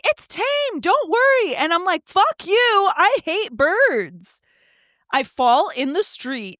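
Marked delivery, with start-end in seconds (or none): 0.0-1.6 high-pitched imitation of the bird owner; mocked Karen. Karen calling to a fleeing walker about her bird, high mocking voice. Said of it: "and she's like". 2.1-4.4 high-pitched retort, drawn out at the end; retold angry cry. Fleeing walker cursing the bird's owner, high retold outburst.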